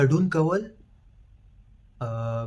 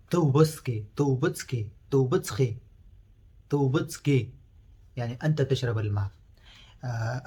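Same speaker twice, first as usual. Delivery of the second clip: ordinary talk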